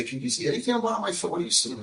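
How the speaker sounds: high, fast, excited question; eager student. Retold or imitated. Student asking the rabbi to read her foot, high and fast.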